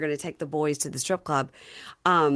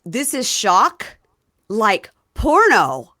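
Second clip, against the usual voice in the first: slow, louder mock astonishment; amazed excitement; 4 semitones higher, 9 dB louder, slower than usual. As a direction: marvel like a first-timer. Husband at strip club.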